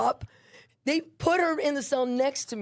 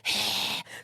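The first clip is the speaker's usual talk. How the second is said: disgusted sigh; 3 dB quieter than usual. Retold or imitated. Woman hearing through the vent she wasn't understood, disgusted sigh. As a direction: imitate a disgusted exhale. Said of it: "So disgusted".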